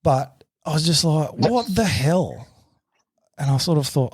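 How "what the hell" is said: high, drawn-out incredulous question; retold disbelief. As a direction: stretch it out in exasperated bafflement. Retold baffled reaction to the man.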